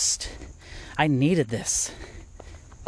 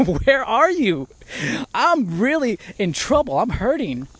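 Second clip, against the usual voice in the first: desperate pleading prayer; 7 semitones higher than usual. Retold or imitated. David crying out to God in trouble, desperate high plea. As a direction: plead with God, pitched up and strained. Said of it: "It's like".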